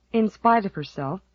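ordinary delivery, nothing marked

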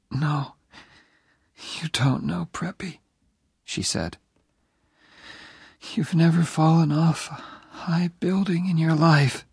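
put-on high, weak whisper of a dying young woman; dying girl's whisper. Dying Jenny asking Oliver to stay near, whispered. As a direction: breathe it out, barely there.

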